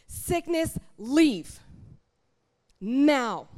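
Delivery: loud, drawn-out command, word by word; commanding prayer. Acted. Preacher showing how a child of God prays, commanding. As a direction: order it out with full authority, pausing before the last word.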